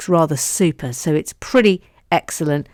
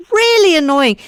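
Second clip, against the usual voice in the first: high, louder Really annoying; exaggerated; 11 semitones higher, 5 dB louder than usual. Mock complaint about her broken mic, pitched high. Repeat in a new voice.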